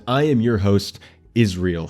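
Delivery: usual speaking voice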